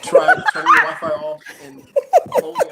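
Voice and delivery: laughing. Real, not acted. Laughing while giving tech advice.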